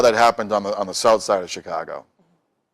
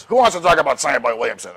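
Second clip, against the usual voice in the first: lightly voiced grumpy old man, higher and louder; cantankerous old man; 6 semitones higher, 5 dB louder, faster than usual. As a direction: bark it out like a gruff old DJ. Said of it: "this little cantankerous old man".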